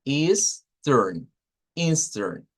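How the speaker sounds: Eastern said the American way, ending in a clear R; American Easter. Shown as a demonstration. Teacher models the American pronunciation for Spanish-speaking students. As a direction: model Eastern slowly with the R sound at the end.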